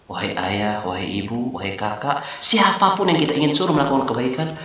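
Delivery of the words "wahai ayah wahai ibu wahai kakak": softer, respectful calling out; teacher modelling tender polite speech; gentle address. Shown as a demonstration. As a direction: address family softly and kindly. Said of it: "gunakan bahasa yang lembut".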